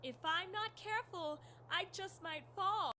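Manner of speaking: high, faint damsel call; fluttering cries for help. Pretend. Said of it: "are you pretending to be a damsel in distress".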